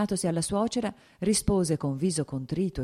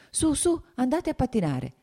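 a high, lilting motherly voice; cheerful urging mother; 9 semitones higher than usual. Acted. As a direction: shoo them off to skate with a sing-song nudge. Mother urging girls onto the ice.

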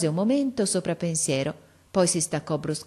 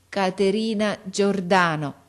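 each syllable slowly and carefully placed; a deaf girl sounding out her teacher's name; slow careful speech; 3 semitones higher, 4 dB louder, slower than usual. Acted. Girl answering the visitor, slowly and carefully.

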